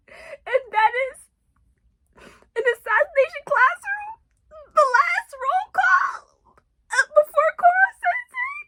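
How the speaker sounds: high, tearful voice, crying through the words; choking up while retelling the scene; real crying. Real, not acted. Retelling a heartbreaking anime scene.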